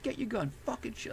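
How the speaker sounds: high, held-back mock yell; retold reckless goading; going nuts. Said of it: "I just went nuts".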